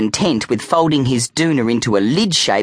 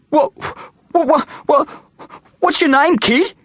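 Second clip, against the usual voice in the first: very high, stammering fear; terrified. A narrator's reading. Bully asking the vampire kid's name, terrified. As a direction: squeak and stumble over the words in terror.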